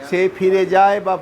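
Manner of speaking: ordinary talk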